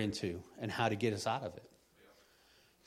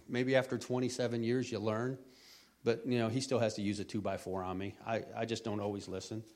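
normal talking voice

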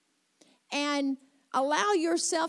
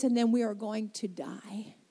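whispered, hopeless; 6 semitones lower, 6 dB quieter than usual. Retold or imitated. Widow whispering to God that she and her son will die. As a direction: breathe out the last words like giving up.

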